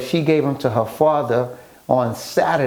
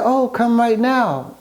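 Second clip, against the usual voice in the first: higher, slower retold lady's voice, lightly done; eager young woman; 9 semitones higher, slower than usual. Retelling her invitation to the host, lightly voiced higher and slower. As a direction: lift the pitch and stretch it out like an eager hostess.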